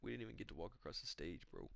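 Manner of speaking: soft, muttering young-dude impression; Joshua Van. Young guy telling older friends about covid, softly mumbled impression. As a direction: quietly reminisce like a soft-spoken young bro. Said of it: "He's like".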